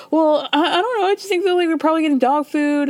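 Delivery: imitating a giddy rich lady; mock eager voice. Host voicing Lisa eager to feed the dogs, giddy. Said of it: "She's like".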